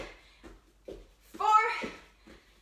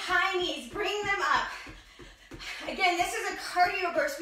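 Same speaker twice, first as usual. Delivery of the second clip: ordinary delivery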